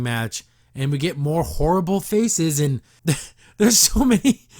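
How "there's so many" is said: laughing. Real, not acted. Laughing at bad faces.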